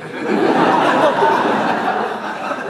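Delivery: high, loud burst of real laughter; real hearty laugh. Real, not acted. Host laughing at the answer.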